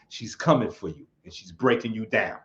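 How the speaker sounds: usual speaking voice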